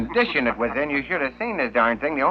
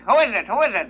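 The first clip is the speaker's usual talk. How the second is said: high squawky parrot repeating itself; 10 semitones higher than usual. Acted. Parrot in the joke squawking at the plumber knocking at the door, high and repetitive. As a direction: play the parrot, pitched well up and chirpy.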